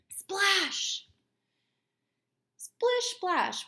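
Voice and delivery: high, loud playful splash; storybook sound effect; excited splash. Acted. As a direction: splash it out for the kids. Reading read-aloud sound words.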